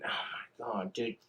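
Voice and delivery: whispered oh my god under the breath; whispered exasperation to himself; frustrated whisper. Real, not acted. Frustrated aside while cooking.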